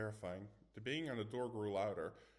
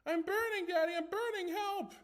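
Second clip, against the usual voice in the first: very high, much louder hammed-up scream; mock child's scream; 8 dB louder than usual. Boy teasing his friends, mock screaming for daddy. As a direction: shriek like a child on fire, overdone for laughs. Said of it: "started screaming again, even louder".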